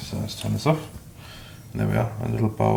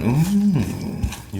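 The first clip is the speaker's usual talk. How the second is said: delighted heart ooh; 8 semitones higher than usual. Retold or imitated. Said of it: "Once your heart's going".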